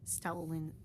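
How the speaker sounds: ordinary delivery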